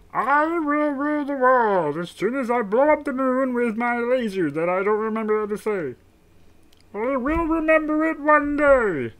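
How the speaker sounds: high, loud cartoon villain boast; strained high character voice; Marvin the Martian. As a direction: declare world domination in a high alien voice. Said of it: "Marvin the Martian".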